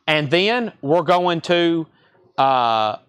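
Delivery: usual speaking voice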